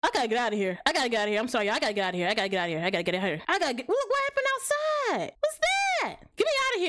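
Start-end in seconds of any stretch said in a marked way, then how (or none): 3.9-6.9 much higher alarmed voice; frightened shock. Real, not acted. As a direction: cry out in fright.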